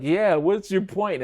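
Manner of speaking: mock getting mad at dad; impatient snap. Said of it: "And I was like".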